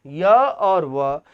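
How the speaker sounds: य और व drawn out very slowly, each sound stretched; slow spelled-out repeat. Shown as a demonstration. Teacher repeats य और व very slowly for students. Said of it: "teacher repeats answer slowly".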